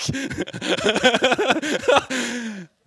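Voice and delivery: high, loud laughing burst; loud laughter. Real, not acted. Preacher laughing at the congregation's reply, loud laugh. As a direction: laugh along with the room.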